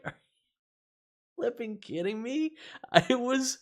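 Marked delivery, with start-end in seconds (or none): 0.0-2.5 high-pitched, drawn-out mock outrage; mock disbelief. Streamer recounting a humiliating game to viewers, mock disbelief. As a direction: retell it as stunned, laughing incredulity. Retelling his reaction to opponent's request.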